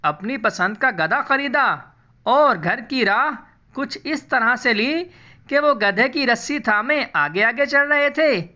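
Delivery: plain reading voice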